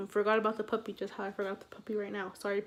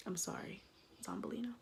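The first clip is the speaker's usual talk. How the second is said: hushed aside, far softer than usual; whispered apology; 12 dB quieter than usual. Real, not acted. Storyteller apologises to the friend she named, whispering. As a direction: whisper it, very soft.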